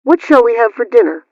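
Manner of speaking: ordinary talk, nothing marked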